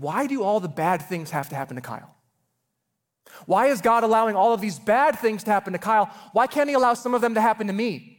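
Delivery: building from plain to high, loud pleading; anguished child. Retold or imitated. Young brother asking God why Kyle suffers, rising to a loud cry. Boy crying over brother's illness.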